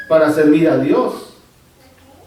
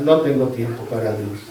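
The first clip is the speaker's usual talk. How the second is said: quiet mock excuse; 9 semitones lower, 6 dB quieter than usual. Paraphrasing excuse, voice dropped.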